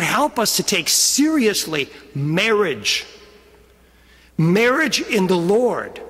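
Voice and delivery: usual speaking voice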